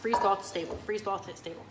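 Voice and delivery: "Freeze thaw stable" rattled off quickly, stumbling; fast tongue twister. Shown as a demonstration. Presenter trying to say it three times fast, quick and fumbling. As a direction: race through "Freeze thaw stable" and trip on it. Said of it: "Say that three times fast".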